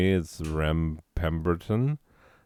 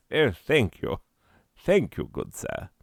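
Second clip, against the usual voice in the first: higher, genteel Southern thanks; lightly Southern gentleman; deep south accent; 5 semitones higher than usual. Shown as a demonstration. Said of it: "I tried to do it".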